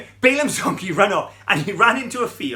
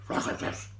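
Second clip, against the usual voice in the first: soft whispered ruff ruff imitation; a quiet, breathy imitation of dog barks; dog barking; 9 dB quieter than usual. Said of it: "They used to say".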